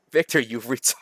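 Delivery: ordinary talk, nothing marked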